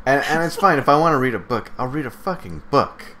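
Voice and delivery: rising irritation, half joking; exasperated rant. Real, not acted. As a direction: vent it like you're fed up. Heated rant about games.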